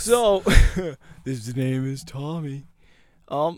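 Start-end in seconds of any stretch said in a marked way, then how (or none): 1.2-2.7 slow, soft character introduction; that silly voice. Pretend. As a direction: draw it out slowly in a mock-serious voice. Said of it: "Every time you do that voice".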